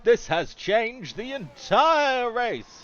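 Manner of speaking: slow, loud, high proclamation; dramatic announcement. Real, not acted. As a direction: declare it like a big moment. Dramatic race commentary moment.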